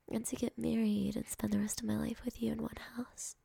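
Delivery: ordinary talk, nothing marked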